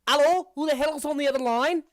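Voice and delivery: high, loud, fast phone answerer; old-timey telephone voice. Acted. Stranger answering the tunnel phone, old-timey caller. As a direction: shout down an antique phone line. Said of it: "like, an old-timey telephone".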